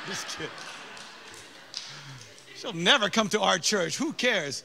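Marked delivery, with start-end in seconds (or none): none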